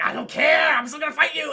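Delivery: high, loud retold defiance; hammed-up shouted challenge; mock defiant yell. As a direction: imitate a raging fighter shouting. Pamela defies machete.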